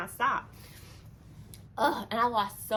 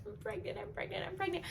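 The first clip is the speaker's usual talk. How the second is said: nervous murmur; 9 semitones higher, 8 dB quieter, faster than usual. Retold or imitated. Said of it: "I'm like".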